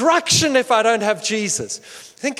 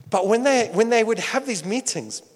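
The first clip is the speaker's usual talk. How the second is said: ordinary delivery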